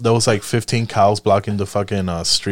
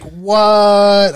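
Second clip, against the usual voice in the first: higher, louder incredulous what; shocked disbelief; 8 semitones higher, 3 dB louder than usual. Retold or imitated. Tourist shocked by dealer's price, higher and incredulous. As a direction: react like you've been ripped off.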